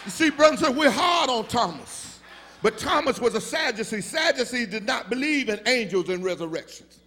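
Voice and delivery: shouting, high, preacher's climax with Jesus's voice rising; full-force preaching. Acted. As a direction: build it to a roaring, sung-out whoop.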